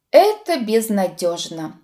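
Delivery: ordinary delivery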